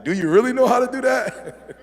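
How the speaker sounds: high, loud, laughing "do you really?"; mock doubtful challenge. Speaker teasing seasoned flyers who skip the safety talk, laughing mock doubt. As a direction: tease the know-it-all flyer with a laugh. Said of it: "I'm like".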